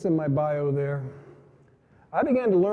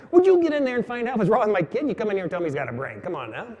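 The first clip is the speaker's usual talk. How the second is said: mock exasperation; 6 semitones higher, faster than usual. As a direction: scold the doctors in comic disbelief. Exasperated father scolding doctors.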